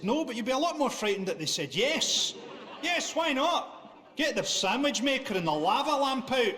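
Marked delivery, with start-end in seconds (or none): 2.8-6.5 eager agreement. Acted. Partner agrees to kinky suggestion, hammed-up eagerness. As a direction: leap at it with gleeful eagerness.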